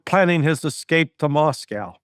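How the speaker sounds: ordinary talk, nothing marked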